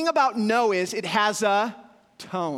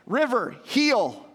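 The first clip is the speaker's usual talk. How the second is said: dog command; 5 semitones higher, 4 dB louder, much slower than usual. Real, not acted. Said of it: "the command, "Heel".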